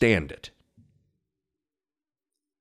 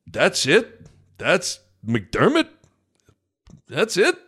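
scoffing, incredulous rising "That's it?"; mock the fan's disbelief, a bit higher; condescending; 4 semitones higher, slower than usual. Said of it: "Like, real almost condescending".

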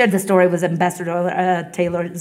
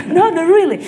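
laughing refusal; 9 semitones higher, 5 dB louder than usual. Retold or imitated. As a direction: laugh through the words, higher and louder. Husband refusing gift, laughing.

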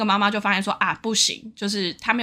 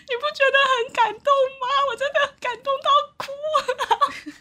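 laughing, teary quote. Retold or imitated. Quoting herself to the scared crowd, high and laughing. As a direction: whine it high through laughter. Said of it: "就我在那边说".

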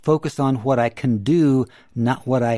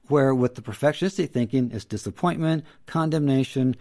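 ordinary talk

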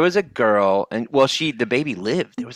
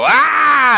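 loud, high cartoon scream; long mock howl of pain; imitated pained yell; 7 dB louder than usual. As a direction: yell as if fingers just got slammed. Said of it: "and he'd go".

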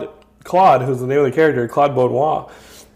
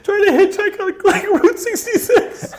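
squealing high through laughter; helpless laughter. Real, not acted. Host cracking up at his own silly character, helpless laughter. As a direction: try to talk while cracking up.

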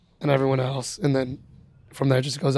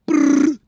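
horn buzz imitation; 12 semitones higher, 4 dB louder than usual. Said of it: "sounded like a goat just got kicked".

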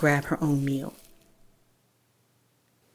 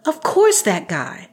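high, loud, exasperated; 6 semitones higher, 5 dB louder than usual. Acted. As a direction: roll your eyes and say it. Exasperated reply.

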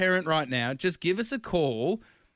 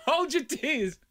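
laughing, higher, slow; 12 semitones higher than usual. Real, not acted. Host teasing co-host to hold back tears, laughing. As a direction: tease through laughter.

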